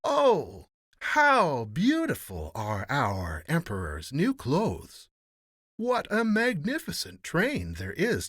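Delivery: loud, high gushing praise; crying out admiringly. A narrator's reading. Crowd admiring the emperor's procession, crying out. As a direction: exclaim slowly, stretching the admiring words. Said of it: "cried out".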